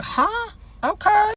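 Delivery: very high, small confused voice; puzzled reaction. Retold or imitated. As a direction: squeak it up like a baffled question. Puzzled by many missed calls.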